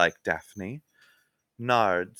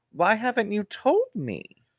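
lightly voiced Wiener; 10 semitones higher than usual. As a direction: go up high as the eager trainee. Wiener's high voice.

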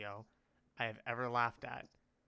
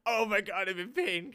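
high, loud imitated agony; mock shocked pain; 7 semitones higher, 10 dB louder than usual. Kid smacked by tuba, mock cry of pain. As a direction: voice the shock, raised and pained. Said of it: "in shocked pain face".

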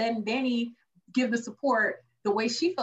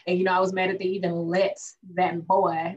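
ordinary talk

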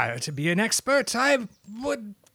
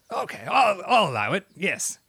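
normal talking voice

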